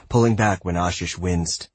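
plain reading voice

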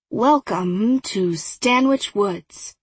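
slow, lightly voiced museum guide; docent voice. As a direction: welcome them like a tour guide. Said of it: "my best imitation of Toby's docent voice".

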